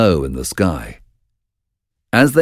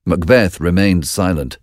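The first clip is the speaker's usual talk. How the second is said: ordinary delivery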